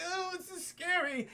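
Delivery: high, slow, whimpering fear; mock fright. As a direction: play a frightened passenger, hammed up. [name] acted scared in the plane.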